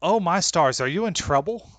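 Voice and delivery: oh my stars, shocked; scolding mom startled on the phone; alarmed Southern mom. Acted.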